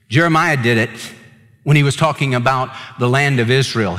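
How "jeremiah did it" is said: ordinary talk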